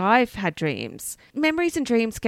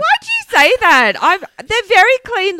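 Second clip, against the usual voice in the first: high, loud mock outrage; offended squeal defending robes; indignant; 11 semitones higher, 8 dB louder than usual. Real, not acted. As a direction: protest much higher and louder.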